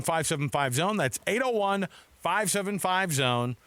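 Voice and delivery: ordinary talk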